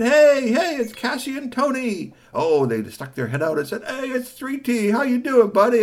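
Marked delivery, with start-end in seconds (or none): 0.0-2.1 high, loud happy hello; excited greeting. Acted. Boy greeting the truck owners, high excited hello. 3.8-5.8 light vendor voice greeting a pal; cheerful vendor. Acted. Vendors welcoming the boy, lightly voiced cheer.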